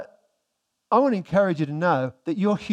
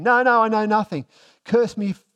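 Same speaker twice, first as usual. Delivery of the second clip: higher, louder, panicked denial; 5 semitones higher, 5 dB louder than usual. Pretend. Preacher voicing terrified Peter's frantic denial. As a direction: play Peter blurting out denials in terror.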